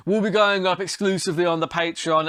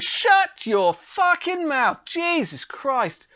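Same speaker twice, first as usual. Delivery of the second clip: much higher, dragged out slow exasperation; exasperated outburst; 10 semitones higher, much slower than usual. Real, not acted. Fed-up vlogger snaps at the fighter, slow high exasperation. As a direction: spit it out in disbelief, slowly.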